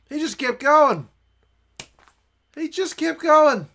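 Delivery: loud, high-pitched cheering disbelief; real excited shouting. Real, not acted. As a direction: yell it as the runner breaks through. Live reaction to a try.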